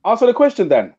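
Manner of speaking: hammering the same demand; insistent. Real, not acted. Pressing the co-host for an answer, insistent.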